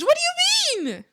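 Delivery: high, loud what do you mean; hammed-up disbelief; playful fake shock; mock outrage. Reacts to losing privilege.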